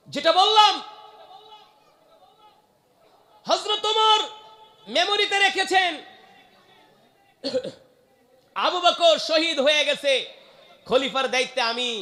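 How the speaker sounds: high, loud ringing phrases with long pauses; full-force preaching. Acted. Preacher resuming Omar's story, high loud proclamation.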